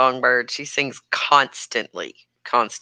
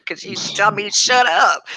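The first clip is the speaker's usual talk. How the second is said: normal talking voice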